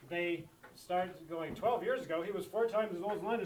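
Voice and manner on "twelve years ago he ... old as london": imitating someone reading straight on, louder and quicker; hurried mock read-through; rushing reader. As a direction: barrel through the words without stopping. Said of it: "this is where people mess up".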